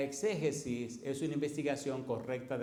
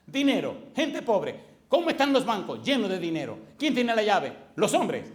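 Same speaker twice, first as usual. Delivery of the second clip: loud, higher, indignant questions; retold angry tirade; 3 semitones higher, 7 dB louder than usual. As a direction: hurl the questions at him. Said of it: "ya estaba rabioso". (preguntas indignadas y fuertes)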